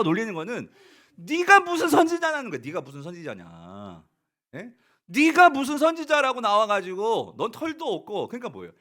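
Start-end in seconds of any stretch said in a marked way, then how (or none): 1.2-2.5 loud high jeering retort; mocking taunt. Children taunting the prophet, loud jeer. 2.6-4.0 low, slow, soft sneer; quiet scornful aside. Retold or imitated. Preacher repeating the children's taunt, soft sneer. 5.1-8.3 loud, higher scornful taunt; mocking jeer. Children mocking the bald prophet, loud jeer.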